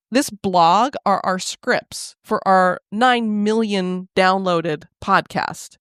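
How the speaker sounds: very slow, spelling it out; slow and pointed. Retold or imitated. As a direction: lay each word down slowly. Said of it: "I'm like".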